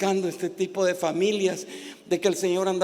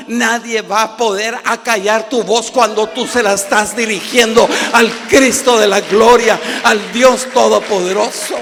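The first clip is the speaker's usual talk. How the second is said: loud, driving sermon delivery building to a roar; full-force preaching; 10 dB louder than usual. Real, not acted. Preacher urging families to cry for mercy, full-force preaching. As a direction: preach it hard, louder and louder.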